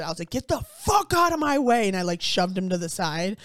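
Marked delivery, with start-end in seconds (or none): 0.3-1.9 higher, louder retold shout; losing it. Retelling shoving past a creepy man on the stairs, losing it. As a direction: snap into a forceful mock yell. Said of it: "I lost it".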